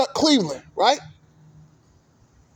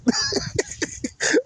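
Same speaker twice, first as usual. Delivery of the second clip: burst of laughter; 5 dB quieter than usual. Real, not acted. Laughing at Warriors fans' collapse.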